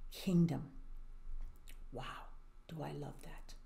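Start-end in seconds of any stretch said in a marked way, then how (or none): 1.9-3.5 delighted whisper. Real, not acted. Storyteller's delighted aside.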